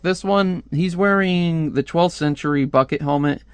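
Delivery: ordinary delivery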